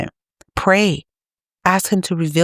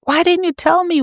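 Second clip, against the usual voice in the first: much higher, anguished cry of reproach; desperate wailing plea; 14 semitones higher than usual. Retold or imitated. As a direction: wail it up high like someone lost and terrified. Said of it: "your loved ones are screaming at you".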